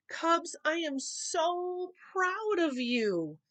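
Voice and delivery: slow, high and proud papa; Papa's voice. A narrator's reading. Papa praising the Cubs, slow and proud. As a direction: stretch it out, a proud father.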